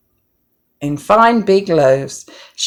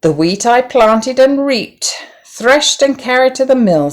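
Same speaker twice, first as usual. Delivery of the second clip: proud announcement; 7 semitones higher, 4 dB louder than usual. A narrator's reading.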